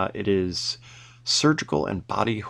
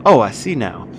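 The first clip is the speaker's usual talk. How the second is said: louder, businesslike and cool; sharper, estranged; 8 dB louder than usual. Acted. Emiliana turns cold on the stranger, sharp and businesslike. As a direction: drop the flirting, go cold. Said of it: "Her voice is sharper and more estranged".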